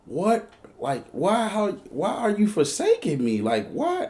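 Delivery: high-pitched bewildered begging; retold plea, voice jumping up; panicked pleading. Man to the woman keeping him, panicked pleading.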